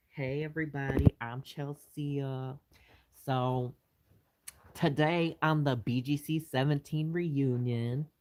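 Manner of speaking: slow, drawn-out Southern reunion host voice; Chelsea. Pretend. Doing a requested line as a Southern reunion host, slow and drawling. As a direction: play a Southern girl greeting her audience, stretching the words. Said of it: "Chelsea will say with her voice".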